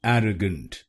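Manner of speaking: एरोगेंट with an open e and a reduced middle vowel; correct pronunciation. Shown as a demonstration. Teacher models the right way, एरोगेंट as AIR-uh-gent. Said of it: "इसकी सही pronunciation है".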